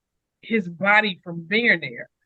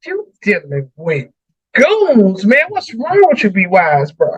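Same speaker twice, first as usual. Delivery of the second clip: exasperated scolding; 6 dB louder than usual. Retold or imitated. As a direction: call him out for picking a fight with goons. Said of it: "He like".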